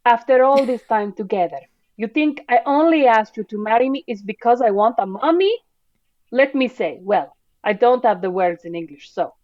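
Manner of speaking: hammed-up Italian accent for a wounded suitor. Co-host acts out Giuseppe's proposal quote, Italian accent. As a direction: play an Italian man protesting in accented English. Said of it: "That's a great Italian accent".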